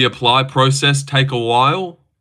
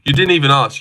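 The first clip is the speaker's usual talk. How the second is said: normal talking voice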